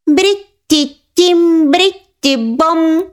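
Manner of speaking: very slow, high drawn-out incantation; magic doll's spell. Acted. The doll enchanting the girls, slow high incantation. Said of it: "вигукнула вона".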